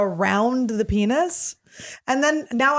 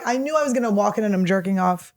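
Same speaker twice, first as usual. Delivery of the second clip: usual speaking voice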